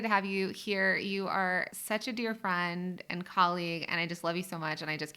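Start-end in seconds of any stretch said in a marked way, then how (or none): none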